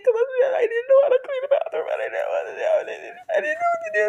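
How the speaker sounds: very high, fast babbled wail; hammed-up blubbering to mom; crying excuse.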